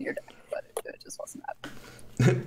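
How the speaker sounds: rushed, breathy stage whisper. Real, not acted. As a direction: drop to a whisper. Trails off into a whisper.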